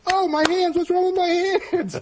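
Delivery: very high, loud hammed-up shriek; exaggerated high-pitched freak-out; mock alarm. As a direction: squeal in comic panic. Acted alarm at baby hands.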